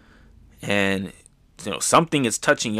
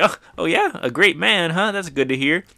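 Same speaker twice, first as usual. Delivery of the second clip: higher, bright pleased brotherly voice; pleased Ace; 7 semitones higher than usual. Retold or imitated. Ace hears praise of his brother, higher pleased voice. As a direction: sound touched by the praise.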